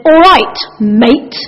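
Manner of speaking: loud, punched "All right", then a separate "mate"; venomous. Shown as a demonstration. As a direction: inject venom, setting "mate" apart. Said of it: "a certain amount of venom can be injected".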